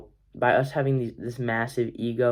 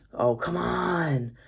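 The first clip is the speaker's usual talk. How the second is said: exasperated; 6 semitones higher, much slower than usual. Retold or imitated. Frustrated reaction to teammate's mistake.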